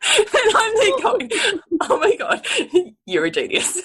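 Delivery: real laughter breaking through the words; laughing. Real, not acted. Mother cracking up at her toddler's comeback, laughing. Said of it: "So I couldn't stop laughing".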